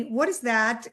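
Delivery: usual speaking voice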